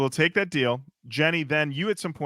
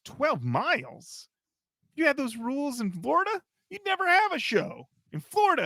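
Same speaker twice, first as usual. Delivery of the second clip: incredulous roadie; 12 semitones higher than usual. Retold or imitated. Roadie mocking the lightning rule in disbelief. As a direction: scoff at twelve miles, climbing high.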